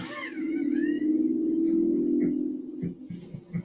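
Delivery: long high-pitched squeal of laughter. Real, not acted. Cracking up at chat's comment, high squealing laugh. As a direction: let out a long shrill laugh.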